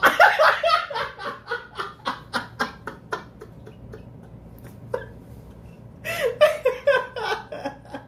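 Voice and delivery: shrieking high laugh; big laugh. Real, not acted. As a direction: burst out laughing hard. Reacting to number result.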